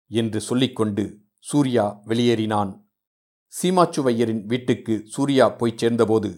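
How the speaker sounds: ordinary delivery, nothing marked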